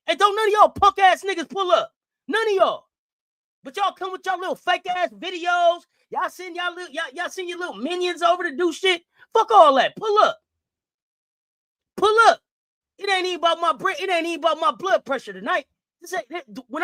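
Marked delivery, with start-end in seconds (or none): 0.1-2.9 loud, higher furious call-out; angry shout. Real, not acted. Streamer yelling at rival fans on his channel, furious. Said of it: "let me get off my soapbox". 9.3-12.4 loud, higher, clipped dare; furious challenge. Real, not acted. Daring rival fans to show up, loud and furious. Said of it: "let me get off my soapbox".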